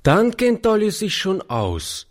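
put-on high-pitched small child; pleased child in a high voice; little girl. A narrator's reading. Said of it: "sagte das Kind".

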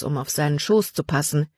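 plain reading voice

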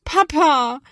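high, loud plaintive cry for dad; scared child calls for dad, loud and pleading. Acted. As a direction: call for dad, close to tears. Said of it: "klingt es schon etwas kläglicher".

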